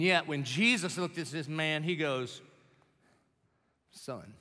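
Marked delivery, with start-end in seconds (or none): 3.9-4.3 tender. Acted. Jesus speaks tenderly to outcast.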